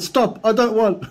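a hammed-up high "stop, I don't want"; mock high refusal. The scammer woman refusing the prize, mock high insistence.

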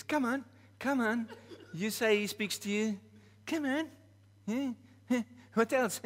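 high, loud mocking woman's voice; a jeering woman, pitched high and loud. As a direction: taunt him in a high, loud voice. Woman taunting him.

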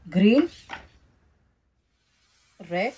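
normal talking voice

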